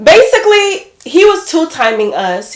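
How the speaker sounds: high, loud burst on basically; emphatic reveal. Real, not acted. Telling her followers the twist, emphatic. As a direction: blurt it out to the viewers.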